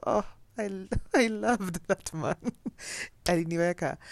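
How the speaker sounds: laughing fondly. Real, not acted.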